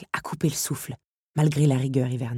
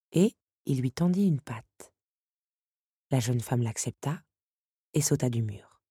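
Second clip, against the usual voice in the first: ordinary delivery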